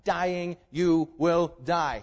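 ordinary talk

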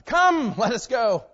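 high, loud echoing call; God's mocking echo. Preacher voices God echoing the builders, high and loud. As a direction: echo their words back loudly. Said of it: "God says".

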